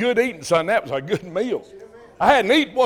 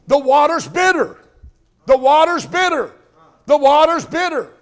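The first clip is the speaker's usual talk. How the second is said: higher, louder relayed cry, "The water's bitter."; passing word back; 5 semitones higher, 3 dB louder than usual. Retold or imitated. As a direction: call it back down the crowd. Word passed back down line.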